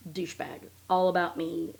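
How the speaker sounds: ordinary delivery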